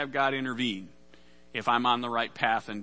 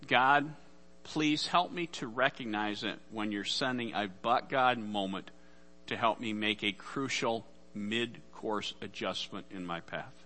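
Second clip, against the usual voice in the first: quiet prayer; 4 semitones lower, 4 dB quieter than usual. Acted. Prays aloud to God.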